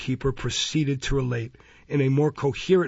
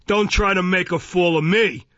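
higher, louder indignant rebuke; Mister Fang, irritable; 6 semitones higher, 6 dB louder than usual. A narrator's reading. Magistrate rebuking a prisoner he thinks shamming, irritable. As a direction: refuse to be fooled, voice raised.

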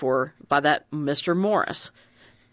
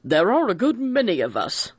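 sharp judge; 4 semitones higher than usual. A narrator's reading. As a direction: cut in sharply like a stern judge. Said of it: "Mister Justice Wargrave said sharply".

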